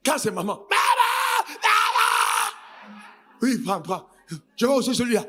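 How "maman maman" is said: put-on loud, wild child's scream; child shrieking at mom in the shop, screaming. (hurlement d'enfant, fort)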